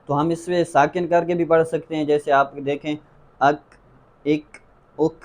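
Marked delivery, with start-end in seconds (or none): 3.4-5.1 "اک" with the final k released in a puff of air; short vowel, then an audible aspirated k in "اک"; hams on Kaf. Shown as a demonstration. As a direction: let breath escape after each "اک". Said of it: "یہ ہمس کی آواز کہلاتی ہے".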